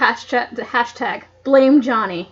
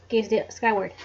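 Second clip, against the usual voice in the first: plain reading voice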